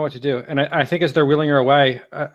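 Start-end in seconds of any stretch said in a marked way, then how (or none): none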